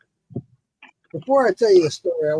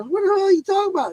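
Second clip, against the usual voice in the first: baffled question; 13 semitones higher, much faster than usual. Retold or imitated. Asking the neighbor, baffled.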